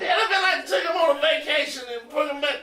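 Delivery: very high squealed complaint; laughing mock protest. Protesting to James about putting the possum back, laughing. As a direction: squeal it, barely holding the laughter. Keeps protesting while cracking up.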